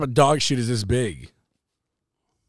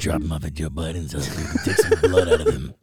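lower-pitched mock conspiracy rant; lightly voiced conspiracy host; Alex Jones impression; 6 semitones lower, 4 dB louder than usual. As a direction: ham up the radio ranter. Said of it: "that was a really good Alex Jones".

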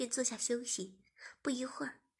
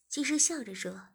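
ordinary delivery

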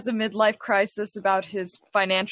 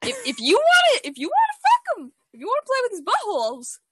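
much higher, fast, mock squeaky; 12 semitones higher than usual. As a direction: squeak it out teasingly. Teasing dad in high voice.

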